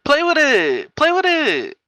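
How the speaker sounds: high, loud taunt; mock loud street woman.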